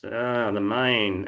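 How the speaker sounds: "Ah, the main," slow, with a long drawn "main" in an Australian accent; lazy Australian drawl on "main"; stretched Australian vowels. Shown as a demonstration. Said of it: "tend to stretch the vowels out".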